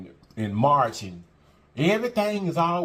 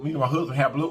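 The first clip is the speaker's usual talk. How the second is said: usual speaking voice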